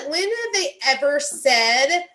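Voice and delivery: rising to an angry demand; angry rant. Real, not acted. Caller ranting about family separation, angry and loud.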